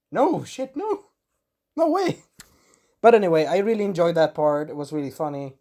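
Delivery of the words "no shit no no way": high, squeaky imitated protest; mock horrified refusal. Legoshi refusing Haru's offer, mock horrified and squeaky. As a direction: pitch shoots up in hammed-up disbelief.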